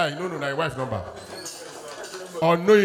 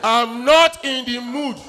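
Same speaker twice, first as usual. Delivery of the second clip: slow, drawn-out mock refusal; 3 semitones higher, much slower than usual. Mimicking a man turning down sex, slow and drawn out. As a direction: stretch it out as the unwilling partner. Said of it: "I never see any man where they reject sex".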